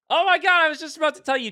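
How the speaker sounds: very high, loud burst easing off; sudden high-pitched excitement; excited surprise. Real, not acted. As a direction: burst out high as if just spotting it. Sudden excited reaction to chat message.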